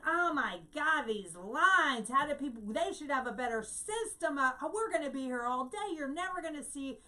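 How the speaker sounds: imitated complaining. Imitating a woman complaining in a Disney World line, hammed-up griping. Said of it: "she was just complaining, complaining".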